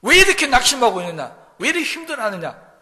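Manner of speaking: loud, high rebuke of his own despair; preacher shouting the psalmist's self-rebuke; scolding. Retold or imitated. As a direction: scold yourself hard for giving up. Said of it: "꾸짖습니다".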